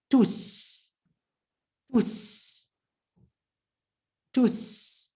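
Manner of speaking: Tus in one go, s unvoiced; single-syllable Tus. Shown as a demonstration. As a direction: join tu and s tightly, Tus. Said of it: "say the tu with s. It's one unit".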